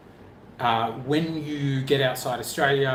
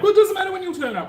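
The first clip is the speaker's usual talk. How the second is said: high, fast and careless; breezy no-worries tone; carefree mock voice; 7 dB louder, faster than usual. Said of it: "if we were both like".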